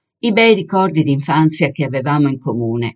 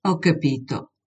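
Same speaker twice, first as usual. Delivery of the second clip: subdued, resigned; 5 dB quieter than usual. A narrator's reading. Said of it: "dissi chinando il capo".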